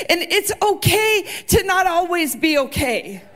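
high, loud impassioned preaching; preacher near tears, pleading loudly; full-force preaching. Acted. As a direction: preach it out with rising passion.

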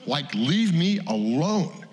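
high, slow, exasperated get-away; irritated brush-off. Retold or imitated. Mike wanting to shove off the pastor, slow and exasperated. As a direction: push the old man off, fed up. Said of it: "And I wanna say".

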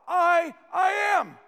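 loud, high proclamation with a pause after "I"; bold claim. Acted. Preacher voices Jesus claiming the title for himself, proclaiming. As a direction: declare it at full preaching force. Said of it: "Jesus is claiming this big title".

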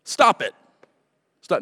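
mocking the stop-it advice; mock stern advice. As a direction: bark the order like a scold. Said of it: "we just go".

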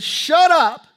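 high, loud order to silence; commanding shout. Acted. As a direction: shout down the storm. Preacher shouts Jesus' command to storm.

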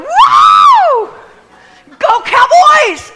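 high, loud whooping shout for the team; wild cheer. Shown as a demonstration. Pastor shows her Super Bowl cheer, a high loud whooping shout. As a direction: cheer like the Cowboys just won. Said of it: "I'm gonna be going".

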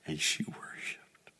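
breathless whisper. Real, not acted. Preacher describing the woman's worship in an awed whisper. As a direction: drop to a whisper in awe. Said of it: "whispered aside".

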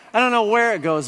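normal talking voice

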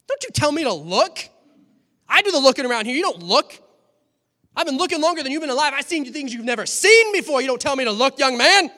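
loud, high, offended scolding; indignant dad. Acted. Old-school dad scolding his son for saying look, indignant. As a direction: put the upstart in his place.

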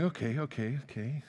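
soft, fading words to yourself; quiet retold nervous self-talk, trailing away; nervous self-soothing. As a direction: reassure yourself under your breath. Calming himself on the table.

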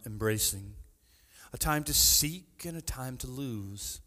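low, measured reading voice; scripture reading. Real, not acted. Preacher reads Ecclesiastes aloud to the congregation, solemn. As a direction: read it solemnly from the page.

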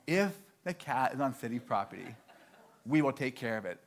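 low, soft clerk repeating the rule; subdued, matter-of-fact recital of policy; understated repetition. Retold or imitated. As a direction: say it quietly, as a knowing confirmation. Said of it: "all she said one more time".

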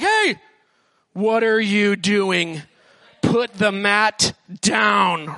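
mock scold from a shocked neighbor; scolding religious leader. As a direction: call him out, then drag the scolding. Scolding religious people voiced.

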